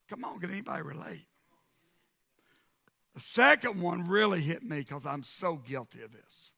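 ordinary talk, nothing marked

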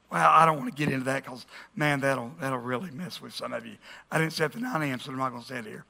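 low, soft aside; muttered aside. Real, not acted. Preacher deciding not to get into it, muttered aside. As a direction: drop right down, as if backing off a topic. Aside dodging a touchy topic.

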